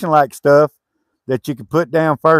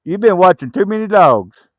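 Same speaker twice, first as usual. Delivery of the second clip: high, loud teasing question; teasing pet talk; 5 semitones higher, 3 dB louder than usual. Real, not acted. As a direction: ask it teasingly in a raised, pitched-up voice. Teasing talk to dog or child.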